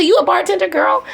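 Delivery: playful disbelief. Retold or imitated. Teasing the young bartender.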